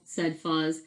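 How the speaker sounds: plain reading voice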